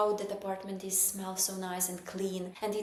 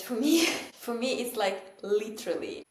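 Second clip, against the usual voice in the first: laughing; 4 semitones higher, 8 dB louder than usual. Real, not acted. Laughing admission about cleaning.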